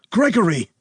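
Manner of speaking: loud, high yell of a name; urgent yell to a collapsing friend. A narrator's reading. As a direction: shout across the hall for Gregory. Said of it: "Bernard yelled".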